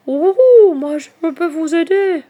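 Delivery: high, loud, hooting owl voice. A narrator's reading. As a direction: call out like a helpful owl. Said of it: "que vous suivez mes ululements". (voix de chouette qui ulule)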